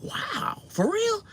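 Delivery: wide-eyed high disbelief; amazed. Retold or imitated. Fresh out of prison, he marvels at the phone, high and amazed. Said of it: "I'm like".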